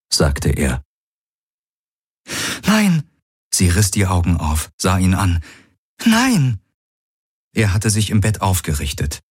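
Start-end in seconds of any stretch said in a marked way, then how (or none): 2.6-3.1 hohe Frauenstimme, verzweifelter Ausruf. Acted. Ki cries out. 6.0-6.6 hohe Frauenstimme, verzweifelter Ausruf. Acted. Ki cries out again.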